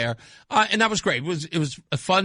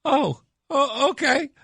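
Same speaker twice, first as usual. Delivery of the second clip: much higher, caught-off-guard thanks; flustered surprise; 10 semitones higher, slower than usual. Retold or imitated. Host retelling his reply to a woman's compliment, high flustered surprise. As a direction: squeak out thanks like you're flattered. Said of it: "And I go".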